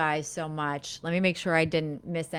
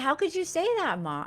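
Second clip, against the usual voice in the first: shocked protest; 10 semitones higher, faster than usual. Retold or imitated. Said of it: "And I said".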